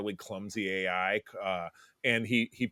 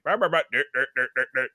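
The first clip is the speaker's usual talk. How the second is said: glitchy stuttering robot voice, higher and louder; Max Headroom stutter; 3 semitones higher, 5 dB louder than usual. Retold or imitated. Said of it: "stutter in the middle and be like".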